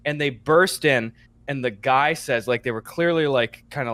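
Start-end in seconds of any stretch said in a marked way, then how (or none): none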